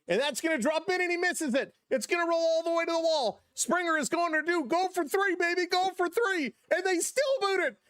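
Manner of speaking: rising to a high, thrilled yell; excited home run call. Real, not acted. Play-by-play streamer losing it over the home run, excited. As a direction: call it like the whole park is on its feet.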